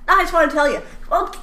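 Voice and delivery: high-pitched flustered reply; mock exasperation. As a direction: play someone thrown by morbid gossip. Acted reply to a rambling gossip.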